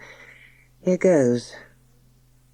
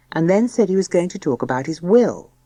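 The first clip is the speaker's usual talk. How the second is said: plain reading voice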